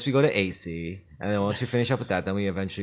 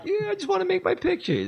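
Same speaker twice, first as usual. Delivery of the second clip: put-on high cranky old man. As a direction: play a fussy old director.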